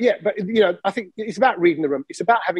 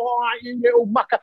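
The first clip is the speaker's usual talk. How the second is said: hammed-up matey ribbing, pitched up; mock rough greeting; 5 semitones higher than usual. Imitating someone ruffling a mate's hair, mock boisterous greeting. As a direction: put on a rough-play hello. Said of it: "I don't go".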